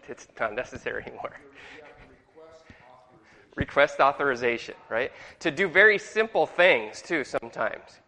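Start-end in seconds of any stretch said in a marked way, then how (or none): none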